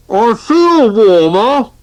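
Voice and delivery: high, slow and muffled under a sheet. Acted. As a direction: speak as if through cloth. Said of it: "came the muffled reply".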